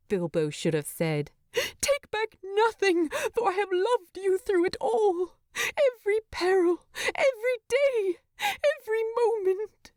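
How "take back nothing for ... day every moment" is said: high, crying, pleading voice; confessing love over a dying man; tearful declaration. Acted.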